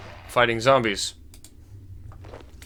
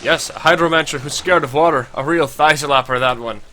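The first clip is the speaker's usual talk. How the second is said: put-on Scottish accent; 2 semitones higher, 4 dB louder than usual. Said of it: "pretty close accent to what he's supposed to have".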